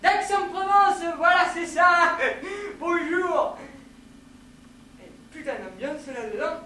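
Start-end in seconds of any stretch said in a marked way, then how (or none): 0.0-3.6 high, loud, with a laugh; boisterous introduction. Acted. Newcomer saying where he is from, loud and laughing. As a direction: call it out cheerily, then laugh.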